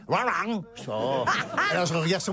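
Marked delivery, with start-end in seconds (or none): 0.1-0.6 high imitation Texan yap; wordless Texan impression, doglike; mimicked garbled Texan. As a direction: repeat a mock-Texan garble, pitched up. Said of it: "Il fait".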